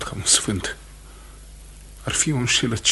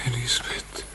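hushed whisper of her name; tender unvoiced whisper; 3 semitones higher, 5 dB quieter than usual. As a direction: breathe her name, barely voiced. Prisoner greets wife in cell.

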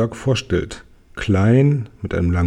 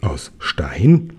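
lower, whispered disbelief; astonished whisper; 5 semitones lower than usual. Acted. Kokosnuss repeats the dwarf's words, whispering in astonishment. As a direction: breathe the question out in amazement. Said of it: "wiederholt Kokosnuss verblüfft".

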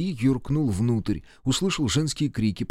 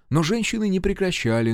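plain reading voice

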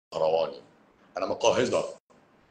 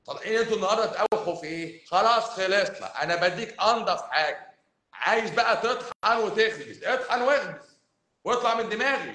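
fired-up rant; 9 semitones higher, 4 dB louder than usual. Real, not acted. Doctor ranting at patients who crave bread, excited and exasperated. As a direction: hammer it home, exasperated.

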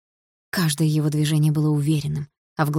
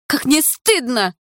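much higher, louder, slow yell; yelling woman; 8 semitones higher, 5 dB louder, slower than usual. A narrator's reading. The woman yells after them, high, loud and slow. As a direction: bellow the shame at them. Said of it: "заорала женщина".

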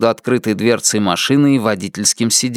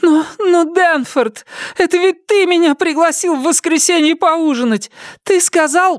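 much higher, yelping; the wife yelping in pain; cries of pain. A narrator's reading. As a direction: squeal as he pulls her hair. Myrtle protesting.

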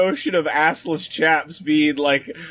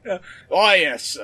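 ordinary talk, nothing marked

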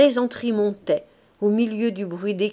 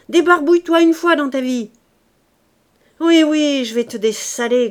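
louder, jeering shouts with every bucket; shouted insults; 3 semitones higher, 6 dB louder than usual. A narrator's reading. The two women shouting insults while throwing water, loud jeering. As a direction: yell each insult as you throw. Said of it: "accompagné d'un éclat de voix".

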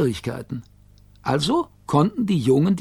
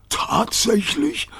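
higher, drawn-out surprise; astonished dog; 7 semitones higher, much slower than usual. A narrator's reading. Rover learning he was overheard, slow high astonishment. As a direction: stretch it out in disbelief.

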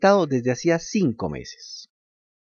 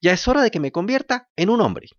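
high, louder fed-up puppet cry; shout; 10 semitones higher, 4 dB louder than usual. Acted. Pinocho, slapping himself, cries out to the fairy in a high frustrated shout. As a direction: yell it in frustration at yourself.

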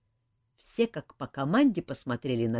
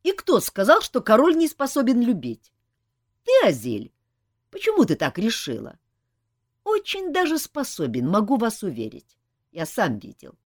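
a mildly angry, louder retort; 10 semitones higher, 8 dB louder than usual. A narrator's reading. Jak snapping at the nymph about death and love, mildly angry. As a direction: brush off her nonsense with irritation. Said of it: "рассердился Жак".

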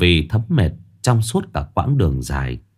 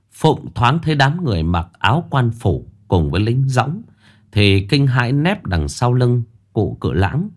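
ordinary delivery, nothing marked

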